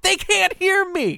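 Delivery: high, loud, drawn-out shout; exasperated retold yell. Driver yells back at the lady chiding him, exasperated. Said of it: "I'll, I'll yell".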